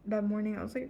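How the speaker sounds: ordinary talk, nothing marked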